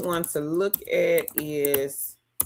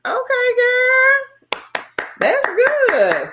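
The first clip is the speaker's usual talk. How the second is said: excited high praise; 7 dB louder, much slower than usual. Real, not acted. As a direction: squeal it high and slow, delighted. Viewer reports stacking eggs.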